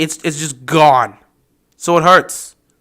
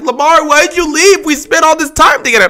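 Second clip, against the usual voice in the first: mock clingy fan; 10 semitones higher than usual. Mocking a fan begging Lamar not to leave. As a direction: plead like a jilted fan.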